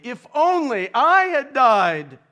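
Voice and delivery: slow, high, loud anguished cry; a preacher crying out the king's despair; emphatic lament. A narrator's reading. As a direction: wail the wish out at full force from the pulpit. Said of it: "He has lamented emphatically".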